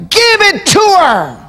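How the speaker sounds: high shouted preaching cry, slowed; shouted refrain. Acted. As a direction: cry it out to the congregation, drawn out and slow.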